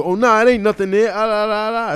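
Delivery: high, loud mock toddler babble; sing-song la-la-la like a kid refusing to listen; three-year-old in denial. Likening humanity to a three-year-old, in high, loud childish denial. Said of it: "like a three-year-old telling you".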